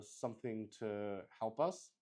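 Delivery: ordinary talk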